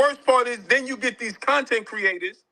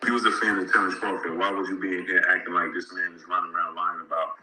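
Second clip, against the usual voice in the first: played clip; 12 semitones lower than usual. Real, not acted. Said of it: "Roll the tape".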